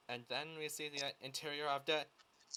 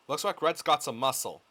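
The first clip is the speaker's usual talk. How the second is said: louder, punchy scripted remark; acted loud line; 11 dB louder than usual. Co-star reading a script line at the start of the scene, loud. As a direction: call it out loudly. Acting a script read, delivered at full volume.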